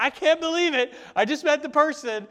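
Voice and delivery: high, loud, breathless excitement; exclaiming in loud, high-pitched delighted disbelief; giddy disbelief. Retold or imitated. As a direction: gush like you can't believe your luck. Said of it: "And you're gonna be like".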